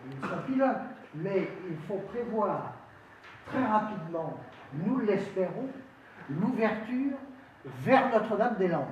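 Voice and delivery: high, loud oratory in separated phrases; rousing political speech. Real, not acted. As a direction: proclaim it to the hall, pausing between phrases. Councillor delivers a formal speech.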